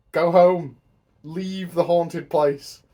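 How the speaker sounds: loud, slow exasperated shout; exasperated yell. Acted. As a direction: yell at the screen. Yelling advice at characters.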